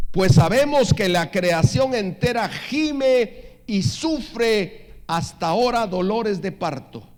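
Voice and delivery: loud, high, drawn-out proclamation; slow scripture reading. Real, not acted. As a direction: proclaim the verse slowly with full force. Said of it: "lo voy a leer despacio". (lectura bíblica lenta, alta y proclamada)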